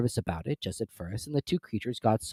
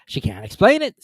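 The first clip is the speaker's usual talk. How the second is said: lazy Griffin; 8 semitones higher, 8 dB louder than usual. A narrator's reading. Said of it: "said the Griffin lazily".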